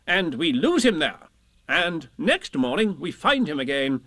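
high, emphatic schemer's voice; bossy, confident Rabbit. Acted. Rabbit explaining his plan to Pooh and Piglet, self-important.

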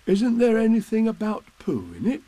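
high-pitched, eager question; hopeful bear. A narrator's reading. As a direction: ask hopefully, wanting a mention. Bear hopes for a mention.

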